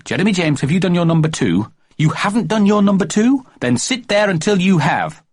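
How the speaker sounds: high mock-stern mother; a child parroting his mum; mimicking Mummy. Jeremy James mimicking Mummy's nagging, high mock-stern voice. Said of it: "Then it was".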